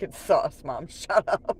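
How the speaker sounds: loud retort to mom, half-laughing after; mock exasperation. Daughter corrects her mom on sauce, loud mock exasperation. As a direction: snap it loudly like a fed-up kid.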